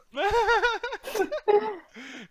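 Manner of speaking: long high-pitched laugh; drawn-out giggling burst; high laughing fit. Real, not acted. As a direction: crack up helplessly. Cracks up at joke.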